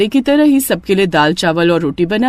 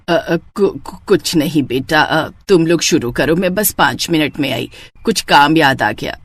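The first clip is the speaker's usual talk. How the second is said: lower, stammering excuse; flustered mother-in-law; 5 semitones lower than usual. Acted. Mother-in-law makes an excuse to leave the table, lower and hesitant. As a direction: stumble over an excuse to slip away.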